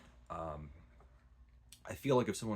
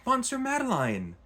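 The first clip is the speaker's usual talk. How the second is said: high, loud cry of recognition; surprised recognition; 8 dB louder than usual. Retold or imitated. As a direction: shout the name in shock, much higher. Gardener recognises Valjean, exclaims name.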